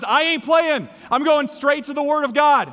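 loud, high defiance of the devil; defiant declaration. Acted. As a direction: shout the devil down with conviction. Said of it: "he looks at the devil and says".

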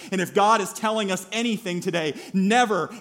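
loud, driving sermon delivery; forceful preaching. Real, not acted. Preacher urging never to write anyone off, forceful preaching. Preacher drives home the point.

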